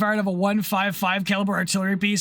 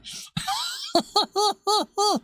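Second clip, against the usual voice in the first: high laugh; 10 semitones higher than usual. Real, not acted. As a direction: burst of high-pitched laughter. Chat request makes him crack up.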